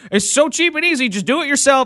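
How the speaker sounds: high, smug know-it-all voice; mocking YouTuber. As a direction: parody a smug commenter. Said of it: "And another YouTuber".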